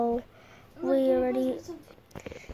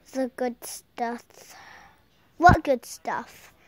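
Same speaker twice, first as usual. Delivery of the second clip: ordinary talk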